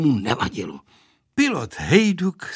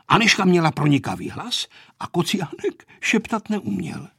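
ordinary delivery

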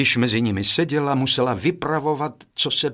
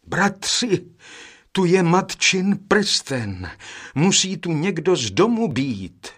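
joyful cry; 6 semitones higher, 3 dB louder than usual. A narrator's reading. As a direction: cry out in joy at finding the ring. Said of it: "vzkřikl radostně nejstarší".

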